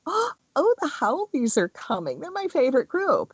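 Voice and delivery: high, sarcastic gushing voice; mock welcoming Hawaiian. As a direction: gush sarcastically about the haoles arriving. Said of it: "the Hawaiians don't go".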